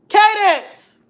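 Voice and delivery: high, loud call of a name; shouting for the granddaughter. Real, not acted. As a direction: call her across the house. Calling granddaughter.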